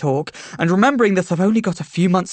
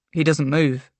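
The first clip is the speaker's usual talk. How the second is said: ordinary delivery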